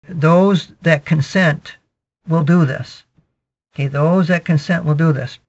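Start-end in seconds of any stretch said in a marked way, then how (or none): 0.1-3.0 drawn out slowly, word by word; slow emphatic. Real, not acted. Preacher drawing out the key line slowly, emphatic. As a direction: lean on each word, slowly. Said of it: "preacher repeats line for emphasis".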